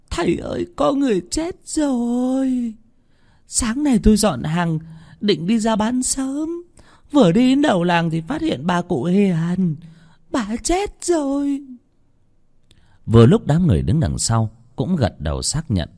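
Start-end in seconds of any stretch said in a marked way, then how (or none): 0.1-11.6 much higher, slow and tearful; whimpering report. Acted. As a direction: sob out the news of the death. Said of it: "Bà Hằng Nước mếu máo". (mếu máo kể chuyện bà cụ Hiền chết)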